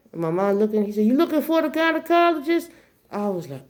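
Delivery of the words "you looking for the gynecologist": high, carrying imitated question; calling out. Driver asks girl and mom for directions, calling out. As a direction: ask it out loud for all to hear.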